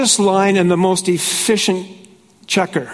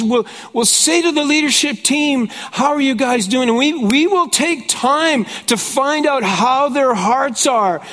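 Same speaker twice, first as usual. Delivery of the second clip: higher, building preacher's cadence; preaching scripture; 6 semitones higher than usual. Acted. Pastor preaching the outpouring to his congregation. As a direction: proclaim the promise with rising fire. Preaching about leaders' pressures.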